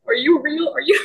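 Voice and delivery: a hint of cartoon starfish; Patrick voice. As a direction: poke his cheek and ask, dazed. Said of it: "using the Patrick voice".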